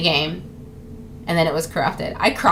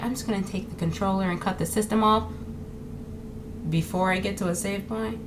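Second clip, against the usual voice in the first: much softer, imitated bedside voice; quietly voiced mom, talking over a sleeper; mom's murmur; 9 dB quieter than usual. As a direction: murmur it under your breath. Mom murmuring while narrator sleeps.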